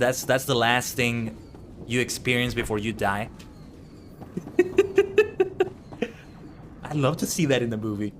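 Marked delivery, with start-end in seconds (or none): 4.2-6.1 evil cackle. Acted. Villain laugh after killing line.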